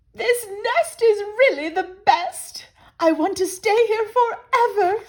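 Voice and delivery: high, loud contented bird voice; high squeaky bird voice, satisfied; happy Missus Bird. Acted. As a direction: sigh with delight over home.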